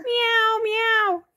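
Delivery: very high kitty meows; cat meow. Shown as a demonstration. Parent echoing toddler's cat sound, very high meow. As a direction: mew twice like a little cat. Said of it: "What does a cat say?".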